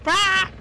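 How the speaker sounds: high loud bird screech; short squawk; parrot screech. Acted.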